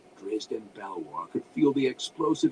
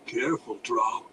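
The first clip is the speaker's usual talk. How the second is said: usual speaking voice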